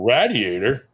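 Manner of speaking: "radiator" beginning with rad; local pronunciation. Retold or imitated. Man baffled by the local car word, "radiator" starting RAD. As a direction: say "radiator" with the a of cat. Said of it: "they say radiator".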